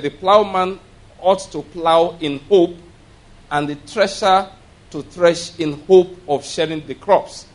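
slow scripture reading leaning on "hope" both times; stress on hope. Shown as a demonstration. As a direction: press the word "hope" with a rising lift. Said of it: "My emphasis here is the word hope".

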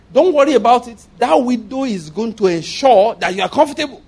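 preacher assures the congregation firmly; emphatic reassurance. Real, not acted. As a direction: press the promise home.

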